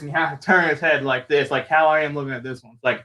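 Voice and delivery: ordinary delivery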